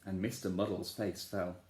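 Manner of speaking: ordinary delivery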